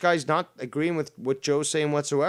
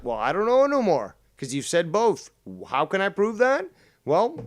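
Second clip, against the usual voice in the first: usual speaking voice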